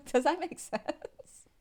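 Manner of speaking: laughing loudly through the question. Real, not acted. Tarot reader laughing at group four while asking. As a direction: tease them with a laugh. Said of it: "I'm laughing at you to make".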